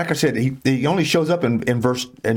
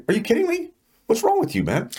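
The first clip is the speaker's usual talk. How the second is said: much higher, incredulous rebuke to yourself; self-scolding disbelief; 13 semitones higher than usual. Retold or imitated. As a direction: scold yourself in mock shock. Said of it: "I look at my own life and say".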